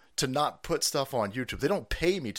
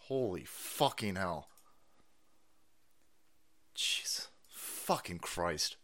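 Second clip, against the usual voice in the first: exasperated muttering; 9 semitones lower, 10 dB quieter, slower than usual. Real, not acted. Muttering at broken stream.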